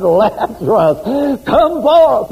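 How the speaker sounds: slow name, then a high loud command; calling out. Acted. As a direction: call him out of the tomb. Jesus calls Lazarus from tomb.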